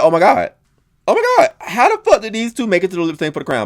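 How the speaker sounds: high-pitched oh my God, then scoffing question; baffled at who made the final; disbelief. Retold or imitated. Viewer reacting to the finalists, incredulous.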